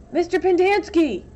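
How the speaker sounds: loud, high call across the dig; shouting. Acted. Boy calling the counselor from his hole, shouting. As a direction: yell for him from the hole. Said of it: "X-Ray shouted from his hole".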